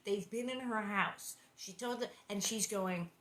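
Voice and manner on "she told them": ordinary delivery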